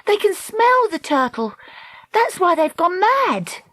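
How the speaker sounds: high, urgent explanation; exclaiming. A narrator's reading. Dakota telling her friend what draws the eels, exclaiming. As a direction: explain it high-pitched, excited by the discovery. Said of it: "Dakota exclaimed".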